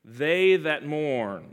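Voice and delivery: very slow, each word stretched; slow, drawn-out phrase. Shown as a demonstration. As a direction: lead the line slowly for all to repeat. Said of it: "Let's say that together".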